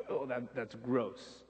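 slow, soft grimace of disgust. Retold or imitated. Preacher shows the disgust the verse should stir. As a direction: recoil at something gross. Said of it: "this reaction ... of disgust".

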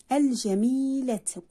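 "الجميلة" with a stretched ii after the m; long vowel demo. Shown as a demonstration. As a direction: hold the ii in "الجميلة". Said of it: "كلمات تحتوي على حرف مد بالياء".

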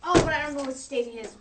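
ordinary talk, nothing marked

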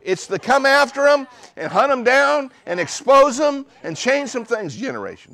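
high, loud preached proclamation; preacher roaring a rallying call; shouting preacher. Acted. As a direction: bellow it as a battle cry. Preacher shouts his slogan.

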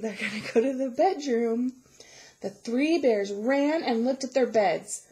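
usual speaking voice

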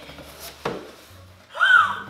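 loud high excited gasp. Real, not acted. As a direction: gasp in delight at a package. Real unboxing surprise.

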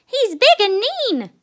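put-on loud, high-pitched child's outcry; crying out. A narrator's reading. Ronnie describes the bully to mother, crying out. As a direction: exclaim it like an alarmed little cub. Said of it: "cried Ronnie".

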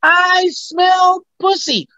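slow, very high drawn-out character line; squeaky, drawn-out comic sniffing-out line; movie catchphrase. Shown as a demonstration. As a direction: stretch each word in a squeaky goofy voice. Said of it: "And another part".

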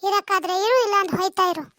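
lightly voiced nagging mother-in-law; a mild hint of an old mother scolding; mother complaining to her son about his wife. Mother-in-law taunts daughter-in-law.

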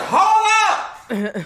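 high sharp shout at full volume; shouted hold up. Retold or imitated. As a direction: yell it out to stop everything. Cousin calls out to stop.

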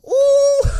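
high, loud startled oh; surprised shout. Real, not acted. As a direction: yelp out in shock. Sudden shock in game. (صرخة مفاجأة عالية)

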